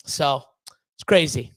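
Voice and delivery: ordinary talk, nothing marked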